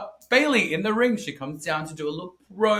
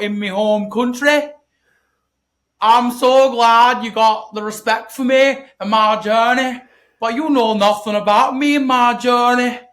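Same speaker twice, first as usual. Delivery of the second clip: loud, slow, higher mock Scottish accent; Piper impression; 4 semitones higher, 7 dB louder than usual. Mocking Piper's promo to Bayley in a loud, slow Scottish accent. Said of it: "do the impersonation".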